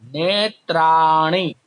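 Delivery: नेत्राणी said slowly and firmly; teacher stressing the plural; slow clear recital. Shown as a demonstration. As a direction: drag out नेत्राणी for the class. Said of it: "बहुवचन में कहेंगे".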